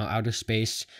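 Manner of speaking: ordinary talk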